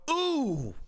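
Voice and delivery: lightly voiced excited commentator; imitating excited Bisping. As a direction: mimic a commentator wowed by a strike. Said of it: "Bisping goes".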